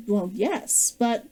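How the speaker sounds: ordinary delivery, nothing marked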